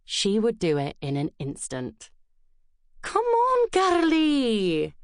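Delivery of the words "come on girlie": hammed-up Scottish burr calling out; Scottish accent. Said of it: "her Scottish burr exaggerated by the rolling of the R".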